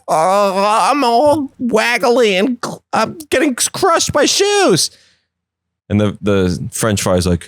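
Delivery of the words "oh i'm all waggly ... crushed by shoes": high, slow, pathetic whine; whimpering pickle. Retold or imitated. Pickle whining about being crushed by shoes, high and slow. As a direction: squeak out your misery. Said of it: "he's like".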